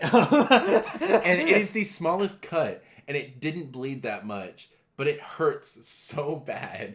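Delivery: high, slow, laughing through words. Real, not acted. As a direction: laugh while complaining. Laughing about his cut finger.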